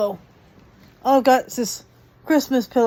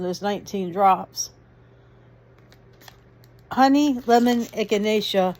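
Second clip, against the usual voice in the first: ordinary delivery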